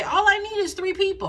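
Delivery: high, mocking voice of a naive founder; a mock founder who thinks three names make a board. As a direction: pitch it up and make them sound clueless. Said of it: "That is the people who say".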